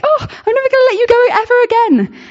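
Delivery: cling to him in delight; overjoyed. Retold or imitated. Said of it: "She was like".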